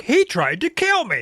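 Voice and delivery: high-pitched mock wail; over-the-top high victim voice; whining accusation. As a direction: cry it out like an outraged tattletale. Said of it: "He's like".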